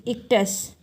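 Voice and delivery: "इक्टस" with weight on the opening ई; stressed first syllable. Shown as a demonstration. Teacher giving an example of an accented syllable, stressed. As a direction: lean on the first vowel of "इक्टस". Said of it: "ई को हम थोड़ा सा लंबा खींच रहे हैं".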